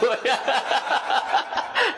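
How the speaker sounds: long high laugh rising up; a rising burst of laughter. Real, not acted. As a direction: laugh hard at the punchline. Preacher laughing at own joke.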